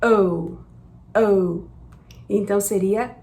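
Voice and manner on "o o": O, O as an English "ow". Shown as a demonstration. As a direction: demo the English O. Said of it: "a pronúncia do O em inglês é".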